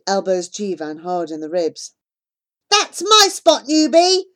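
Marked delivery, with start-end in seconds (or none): 2.7-4.2 loud, higher yell at a newcomer. Acted. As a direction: shove him away with your voice. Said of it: "he yells".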